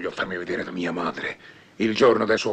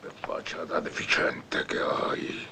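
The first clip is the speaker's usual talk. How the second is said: slow, breathy whispered insult; contemptuous whisper; 5 dB quieter, slower than usual. Acted. Boss to his double, a slow contemptuous whisper. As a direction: hiss it close to his face.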